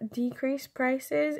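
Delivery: ordinary talk, nothing marked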